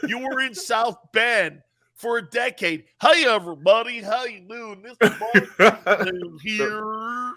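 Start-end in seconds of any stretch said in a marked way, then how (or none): 3.0-7.3 mock Cajun accent. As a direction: lightly imitate a phony Cajun coach. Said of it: "that Cajun accent".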